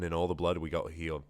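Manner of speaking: ordinary delivery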